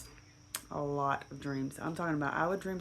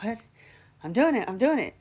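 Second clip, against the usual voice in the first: higher, louder thrilled cry; excited disbelief; 7 semitones higher, 8 dB louder than usual. Retold or imitated. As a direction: blurt it out amazed it's working. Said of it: "so I was like".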